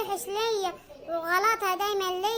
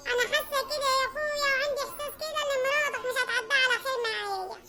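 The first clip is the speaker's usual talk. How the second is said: sister-in-law voice; 2 semitones higher than usual. Acted. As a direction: stir up trouble against the wife. Suad complains again.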